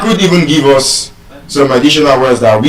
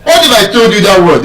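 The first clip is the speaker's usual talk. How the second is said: normal talking voice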